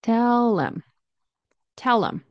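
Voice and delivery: "Tell 'em" as tellem; linked 'em. Shown as a demonstration. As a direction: join "tell" to a light "em". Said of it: "we're gonna link 'em".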